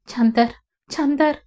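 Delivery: whimpered, pleading name-calling; tearful sobbing. A narrator's reading. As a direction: call out his name through mild sobs. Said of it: "மனோகரிணி தேம்ப".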